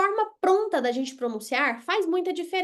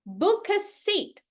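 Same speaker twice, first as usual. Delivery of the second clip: "Book a seat" said slowly and a bit higher; slow demo; 5 semitones higher, much slower than usual. Shown as a demonstration. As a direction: draw out "Book a seat" for the learners. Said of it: "Reservar um quarto".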